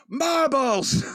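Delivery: high hammed-up cry of the last word; mock yell. Tanaka finishing his yelled insult from the phrasebook, hammed-up high. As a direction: land the word like a triumphant shout. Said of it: "He's yelling at him".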